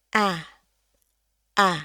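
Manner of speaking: A dengan senyum lebar dan rahang turun; smiling A. Shown as a demonstration. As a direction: ucapkan A sambil tersenyum lebar. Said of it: "smiling A". (A senyum)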